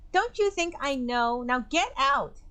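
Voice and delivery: grieving furious dismissal; bitter anger. Acted. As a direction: snap back then dismiss. Clarissa grief and anger.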